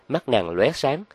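plain reading voice

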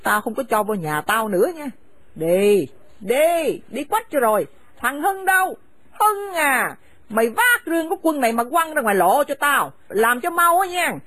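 harsh, contemptuous scolding of a daughter-in-law; bitter older woman driving her son's wife from the house; angry scolding mother. Acted. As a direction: spit the insults at her and order her out. Mother orders them out.

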